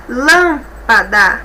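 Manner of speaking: "Lâmpada" very slow, first syllable LÂM held. Shown as a demonstration. Teacher showing three syllables, "Lâmpada" slowly split with stress on LÂM. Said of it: "basta separar as sílabas".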